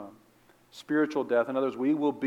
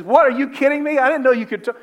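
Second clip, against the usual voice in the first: much higher, louder, disbelieving cry; astonished outburst; 12 semitones higher, 9 dB louder, faster than usual. Retold or imitated. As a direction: blurt it out in total shock. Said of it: "He says".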